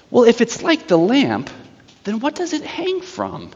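high, soft, sly witch voice; witch feigning innocence. Retold or imitated. Witch undermining the children's answer, high soft mock innocence. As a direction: question sweetly, trailing into a hushed high tone.

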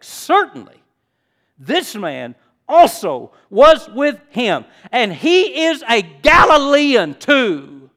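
"this man also was with him" slow, loud, word by word; emphatic insistence. Acted. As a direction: build to a loud, high "Galilean too". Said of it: "another man began to insist".

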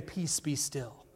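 soft, slow whisper; whispered calm authority; whispered command. Retold or imitated. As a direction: breathe the words out. Jesus calms the storm, retold.

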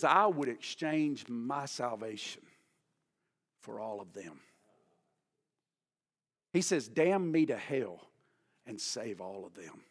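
earnest plea. Acted. Paul's heartfelt plea quoted.

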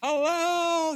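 high, loud, slow call; screaming. Acted. Holy Spirit calling out to the congregation, loud and high. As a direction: yell it across a distance. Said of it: "he's screaming".

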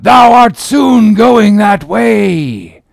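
high, loud, long-drawn warning; prophetic cry. A narrator's reading. Gabriel foretelling Ahab's death, high drawn-out shout. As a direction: cry out a slow, ominous prophecy. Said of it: "cried Gabriel to Ahab".

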